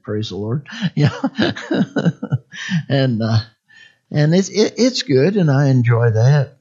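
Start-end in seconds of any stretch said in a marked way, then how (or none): 1.1-2.8 high run of chuckles; real chuckle. Real, not acted. Preacher joking about caffeine in warm milk, chuckling. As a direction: chuckle at your own little joke. Laughs at own milk joke.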